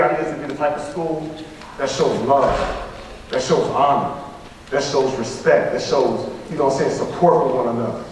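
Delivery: low and sincere, laying out values; earnest and low. Real, not acted. Lowers voice for core values.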